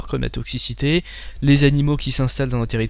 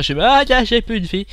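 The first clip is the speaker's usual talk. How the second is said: much higher, louder burst of alarm; panicked cry; 9 semitones higher, 6 dB louder than usual. Real, not acted. Player sees his life bar empty, alarmed cry. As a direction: yelp as your health hits zero. Health runs out in game.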